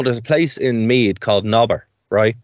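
ordinary talk

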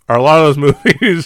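ordinary talk

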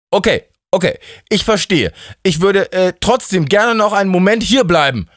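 high-pitched, urgent character voice; anxious bargaining. Acted. Steffi hastily bargains to live. (drängend bittend, mit hoher Stimme)

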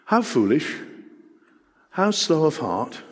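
gently chiding, each phrase set apart; mild rebuke. Acted. Jesus chiding two followers, mild rebuke. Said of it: "he begins with a rebuke".

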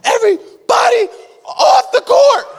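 high, loud laughing shout. Retold or imitated. Family yelling for everyone to clear the court, high and loud. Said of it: "the rest of my tribe is like".

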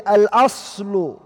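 each Arabic word drawn out slowly; slow, weighty recitation of a scholarly rule; slow recited maxim. Shown as a demonstration. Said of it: "they say".

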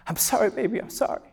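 soft, choked-up murmur; imitated tearful apology. Father reunited with his crying little girl, softly voiced tearful apology. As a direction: retell a crying father's apology, hushed and tender. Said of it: "He's crying".

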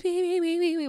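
high squealing puppy cries; puppy whine. Retold or imitated. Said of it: "he starts".

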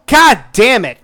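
normal talking voice

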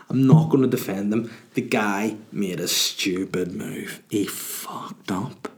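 normal talking voice

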